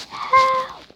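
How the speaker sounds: "Help" cried out very high; yelled "Help". Shown as a demonstration. Gamer showing how to call for rescue, yelled "Help". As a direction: yell "Help" straight out, pitch leaping up. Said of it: "just yell".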